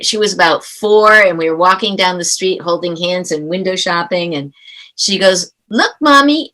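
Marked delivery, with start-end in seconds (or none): 5.7-6.5 high-pitched imitation of a little kid; little megaphone child voice. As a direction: mimic a small child's carrying voice. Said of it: "in that little megaphone voice they have".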